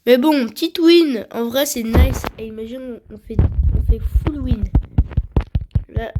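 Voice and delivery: normal talking voice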